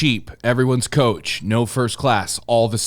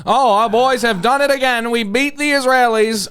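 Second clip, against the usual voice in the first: high, ringing mock victory cry; hammed-up flag-waving celebration; patriotic proclamation; 10 semitones higher, 4 dB louder than usual. Summing up the film's ending as propaganda, mock-triumphant high voice.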